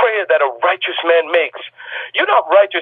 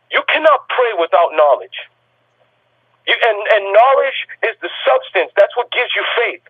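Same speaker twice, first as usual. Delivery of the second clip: normal talking voice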